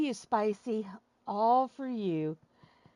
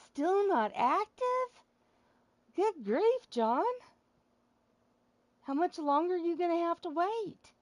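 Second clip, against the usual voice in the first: exasperated; 7 semitones higher, 4 dB louder than usual. Real, not acted. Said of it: "impatient waiting for connection".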